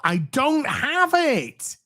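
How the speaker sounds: high, drawn-out protest; high-pitched emphatic denial. Real, not acted. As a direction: stretch it out high in exasperation. Emphatic protest, then softer repeat.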